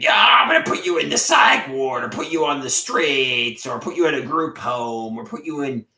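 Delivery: hammed-up yelling threat; mock shouted threat from mother; imitated mother yelling. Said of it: "she's gonna be like".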